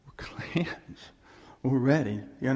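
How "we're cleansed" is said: breathy hushed whisper. Acted. Preacher to his flock after the verse, whispered. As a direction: whisper it like a secret relief.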